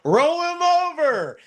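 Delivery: high frantic yelled order; retold high-pitched scream to roll him over; urgent scream. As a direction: shriek it like a panicked nurse. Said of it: "she literally screamed".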